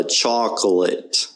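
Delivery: slow "chocolate" as CHOK-uh-lit; schwa in chocolate. Shown as a demonstration. Teacher showing the uh, "chocolate" slowed with a schwa. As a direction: say "chocolate" slowly with uh for the O. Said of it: "It's an, uh".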